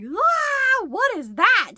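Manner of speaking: high, loud startled gasp at something strange; startled cry. Retold or imitated. To herself, spotting a furry thing in the window, startled.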